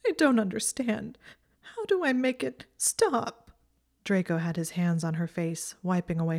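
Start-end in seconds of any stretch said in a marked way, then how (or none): 0.1-3.5 whining through tears. A narrator's reading. Hermione sobbing, frustrated she cannot talk to Draco. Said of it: "through her tears".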